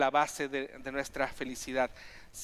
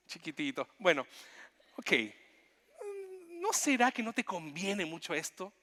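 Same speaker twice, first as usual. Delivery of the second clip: tender Jesus; 4 semitones higher than usual. Acted. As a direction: speak to him like a hurt child. Jesus tenderly speaking to Jonah.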